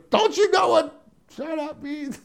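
shrill, high, slow mock fury; angry neighbor. Angry neighbor snapping at the smug man, high mock fury.

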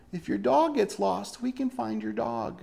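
syrupy mock advert; mock sales pitch. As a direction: sell it like a sweet commercial. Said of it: "what they sold it as".

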